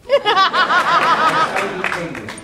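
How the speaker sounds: slow, hammed-up English accent drawn out with a laugh; mock English accent. Actor tells fans he's from England in an English accent. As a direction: lays on a posh-ish English accent as a joke. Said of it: "I'm actually from Britain".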